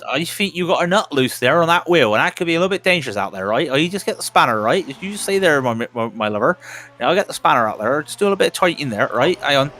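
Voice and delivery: comic West Country spannerman; West Country mechanic. Retold or imitated. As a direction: put on a broad West Country accent to tease the driver.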